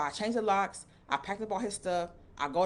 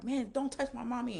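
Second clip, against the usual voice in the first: lightly voiced kid, higher pitched; little boy's yell; 8 semitones higher than usual. Young son confronting the man who scared his mommy, higher kid voice. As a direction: pitch it up like a small boy standing his ground.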